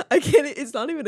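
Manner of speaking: cracking up at herself; real laughter while confessing; laughing. Real, not acted. Laughing at own bad accent.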